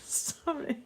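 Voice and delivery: real high-pitched laughter breaking up the words; helpless giggling. Real, not acted. Host apologising to viewers mid-demonstration, helpless giggling. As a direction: try to apologise through a fit of giggles. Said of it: "I can't stop laughing".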